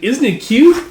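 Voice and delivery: high, loud, gleeful question; delighted squeal. Acted. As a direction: show it off proudly. Girl delighted at braided mane.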